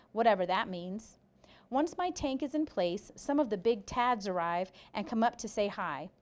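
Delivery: ordinary delivery, nothing marked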